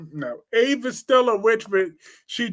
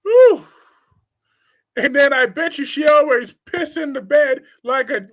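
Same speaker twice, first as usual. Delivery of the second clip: ordinary talk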